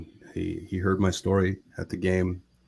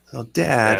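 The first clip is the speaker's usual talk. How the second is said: louder, higher, correcting his dad; exasperated son; 5 semitones higher, 7 dB louder than usual. Retold or imitated. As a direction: imitate a son setting Dad straight. Imitates son correcting his father.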